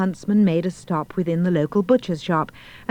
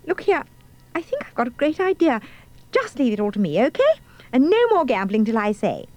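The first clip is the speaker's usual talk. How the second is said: put-on higher, bossy girl's voice; 6 semitones higher, faster than usual. A narrator's reading. Girl laying down the law to dwarfs, high and bossy. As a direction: take charge in a pert high voice.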